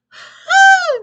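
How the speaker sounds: a sudden very high, loud squeal of delight; high excited whoop. Real, not acted. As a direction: whoop like a fan in the stands. Cheering on the manager.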